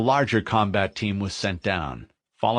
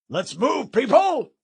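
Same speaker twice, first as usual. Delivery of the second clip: shouting; 3 dB louder than usual. Acted. Sergeant rallying troops.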